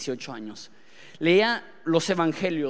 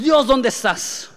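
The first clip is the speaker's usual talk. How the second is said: slow, higher, louder plea to God; cry to heaven; 8 semitones higher, 8 dB louder, slower than usual. Retold or imitated. Believer in the storm shouting up to God, slow and loud. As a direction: call out desperately to the sky. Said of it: "gritas al cielo y dices".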